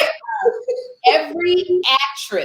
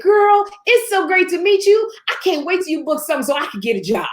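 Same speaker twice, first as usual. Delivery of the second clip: gushing friend; 10 semitones higher than usual. Retold or imitated. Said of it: "She said".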